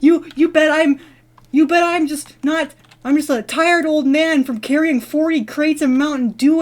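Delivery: a hint of a guard hollering; mock screaming. Guard ranting to the dreamer about crates, mock screaming. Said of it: "He was screaming at me".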